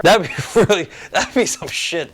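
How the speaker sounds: laughing through the words; amused real laugh breaking into the words. Real, not acted. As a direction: giggle out the line at a wild idea. Laughing at own idea.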